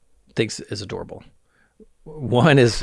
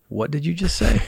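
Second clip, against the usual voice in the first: mock baffled question, pitched up; 7 semitones higher than usual. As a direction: imitate a puzzled wife, voice lifted. Retold wife's baffled reaction.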